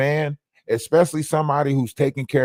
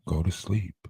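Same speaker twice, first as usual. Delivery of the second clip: deep lullaby voice; 7 semitones lower, 8 dB quieter than usual. Pretend. Lulling to sleep, repeated.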